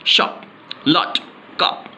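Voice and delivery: "शॉट, लॉट, कप" as separate short bursts; quick short O. Shown as a demonstration. Teacher drills words to be said quickly, quick short O. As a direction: keep the vowel brief in "शॉट", "लॉट", "कप". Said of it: "जल्दी से".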